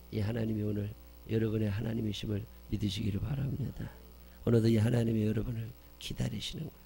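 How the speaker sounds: ordinary talk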